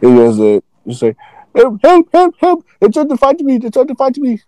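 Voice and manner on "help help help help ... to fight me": high, frantic cry for help; panicked man's voice. Retold or imitated. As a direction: squeal it like a scared guy begging for help. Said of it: "not you just put that voice on".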